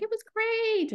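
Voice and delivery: high, bright mock-cheerful answer; sunny reply to the manager; chirpy reply. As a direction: play your past self, all sunny. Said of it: "and I was like".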